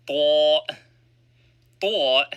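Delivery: "Thaw" with a heavy emphatic t; emphatic taw. Shown as a demonstration. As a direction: push "Thaw" out emphatic and full. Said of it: "Thaw has an emphatic pronunciation".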